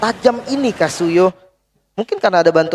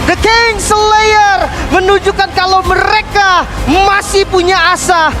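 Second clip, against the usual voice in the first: high, loud victory call; hype shout; 12 semitones higher, 4 dB louder than usual. Real, not acted. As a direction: shout the comeback with joy. Co-caster celebrates the win.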